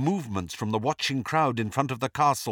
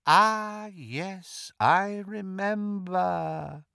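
Voldemort; 7 semitones higher, much slower than usual. A narrator's reading. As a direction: savour the memory in long, drawn-out words. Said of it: "said Voldemort".

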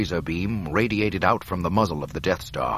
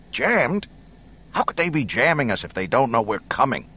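high, urgent disbelief; alarmed realisation, voice up; 5 semitones higher, 4 dB louder than usual. Acted. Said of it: "He grimaced".

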